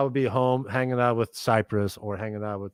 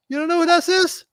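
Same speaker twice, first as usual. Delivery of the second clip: much higher, louder little voice; proud uncle introducing his nephew, in a high little voice; 13 semitones higher, 8 dB louder than usual. Retold or imitated. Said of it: "in his little voice".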